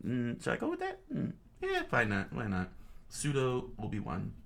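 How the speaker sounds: ordinary talk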